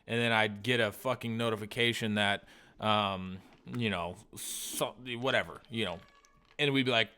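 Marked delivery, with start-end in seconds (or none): none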